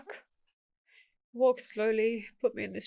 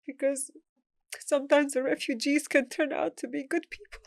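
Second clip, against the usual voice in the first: choked up; 5 semitones higher than usual. Real, not acted. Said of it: "It's just been emotional".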